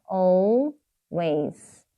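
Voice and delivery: "Always" split slowly into Al, ways; syllable by syllable. Shown as a demonstration. Teacher showing learners the new word "Always", syllable by syllable. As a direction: draw out each half of "Always". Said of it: "항상이라는 뜻이에요".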